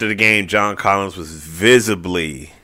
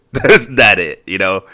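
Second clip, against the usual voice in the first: laughing; 5 dB louder than usual. Real, not acted. Podcaster laughing off the fans' Sharif Cooper idea, laughing and louder. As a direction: laugh the dismissal out. Laughing dismissal of his argument.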